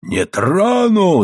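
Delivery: high, long-drawn roar; defiant yell. A narrator's reading. Husband yelling back at his wife, enraged. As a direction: howl it back, stretching the vowel. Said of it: "заорал Моррелл".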